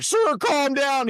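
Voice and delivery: high-pitched, drawn-out mock shout; escalated order. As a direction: imitate a frantic attendant shouting. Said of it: "And then he's like".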